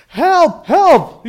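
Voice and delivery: loud, high acted shout for rescue; crying out for help. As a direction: holler up from the cliff, acting stranded. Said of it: "Jack began to cry out for help".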